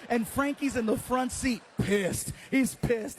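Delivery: ordinary talk, nothing marked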